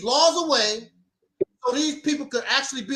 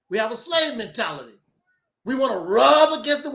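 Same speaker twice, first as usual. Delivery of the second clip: ordinary talk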